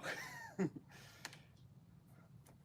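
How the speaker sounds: high, breathy laugh trailing off; self-conscious laugh. Real, not acted. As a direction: laugh at your own slip. Laughs at own low talking.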